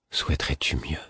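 soft, low, near-whisper question; barely audible. A narrator's reading. The Fool questions the narrator, barely audible. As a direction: ask it almost inaudibly, under the breath. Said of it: "d'une voix moins audible qu'un chuchotement".

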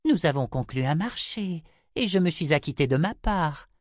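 high, measured seer voice; Chota, unyielding. A narrator's reading. Chota holds Richard to their bargain, high unyielding voice. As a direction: state it high and firm.

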